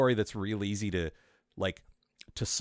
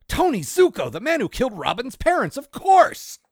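much higher and louder, shouting; excited dramatic reveal; 13 semitones higher, 9 dB louder than usual. Acted. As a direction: shout it like a big twist.

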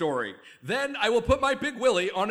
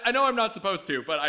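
ordinary talk

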